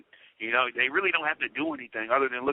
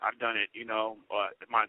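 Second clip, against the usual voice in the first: normal talking voice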